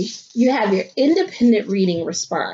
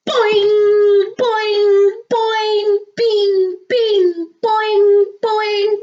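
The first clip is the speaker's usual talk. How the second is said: high, loud springy boings; boing sound effect; 11 semitones higher, 5 dB louder, much slower than usual. A narrator's reading. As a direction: bounce each boing like a spring.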